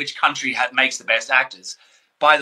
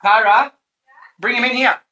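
cheering call-out; 13 semitones higher, 4 dB louder than usual. Real, not acted. Cheering for friend's coming baby.

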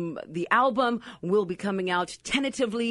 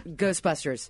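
usual speaking voice

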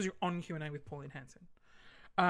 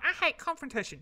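high, loud mock squeal; self-quote pitched way up; mock protest; 9 semitones higher, 6 dB louder than usual. Mock self-quote, acted contrast.

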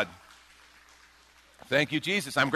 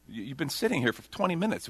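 ordinary talk, nothing marked

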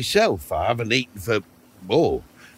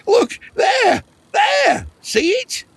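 excited pointing; 5 dB louder, slower than usual. Acted. Old man points at vanished box.

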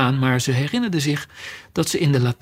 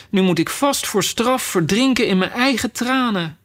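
higher-pitched, mildly woeful complaint; rueful lament; 7 semitones higher than usual. Acted. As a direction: lament your own silly plight. Alice frets in her tears.

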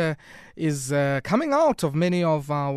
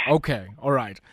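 ordinary delivery

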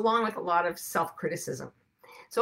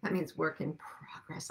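unvoiced whisper, much softer and lower; hushed comic aside; 4 semitones lower, 11 dB quieter than usual. Real, not acted. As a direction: whisper it like a secret joke. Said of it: "whispered translation aside".

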